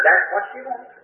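mock-confident insistence; insistent. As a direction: bluff like you know exactly. Insisting to the shop girl.

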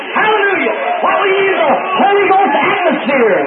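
fervent preacher's cry; preaching. Real, not acted. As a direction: lift it to a shout of praise. Preacher rises to a shout.

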